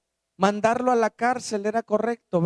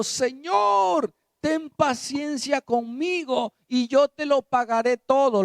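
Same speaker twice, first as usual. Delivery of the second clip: desperate plea; 7 semitones higher than usual. Acted. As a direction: cry out for mercy on your knees. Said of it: "Le suplicaba diciendo".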